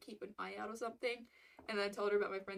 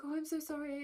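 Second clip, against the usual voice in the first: high-pitched acted apology; apologetic; 6 semitones higher than usual. Server apologizing for a wrong order, apologetic.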